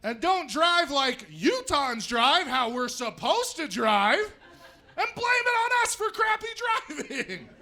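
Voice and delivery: mock rant. Ranting at out-of-state drivers.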